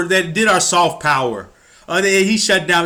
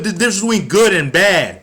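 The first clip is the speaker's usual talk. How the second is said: ordinary talk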